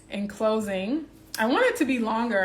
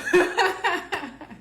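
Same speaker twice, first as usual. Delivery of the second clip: high, loud burst of laughter; delighted laugh; 10 semitones higher, 6 dB louder than usual. Real, not acted.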